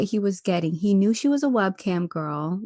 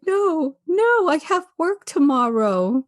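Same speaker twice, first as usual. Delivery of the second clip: mock pleading man; 8 semitones higher, 5 dB louder, slower than usual. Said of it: "He's like".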